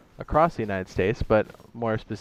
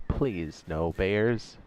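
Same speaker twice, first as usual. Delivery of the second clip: slow drawn-out plea; 2 semitones lower, 4 dB quieter, much slower than usual. Real, not acted. Streamer walking back to his carcass, dreading a bear, slow plea. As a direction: stretch every word, dreading it.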